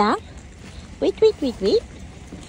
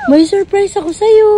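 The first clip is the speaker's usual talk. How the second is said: high, loud teasing announcement; excited surprise; 7 semitones higher, 8 dB louder than usual. Real, not acted.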